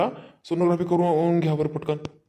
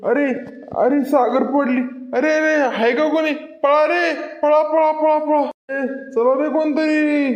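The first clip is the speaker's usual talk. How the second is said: calling for help; 8 semitones higher than usual. Acted. Man calls neighbours for help after Sagar falls, panicked shouting. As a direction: shout for anyone to come running.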